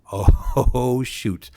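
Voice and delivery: hammed-up groan of a provider out of options; exaggerated retold dismay. Provider asked for a bed in two weeks, comically dismayed.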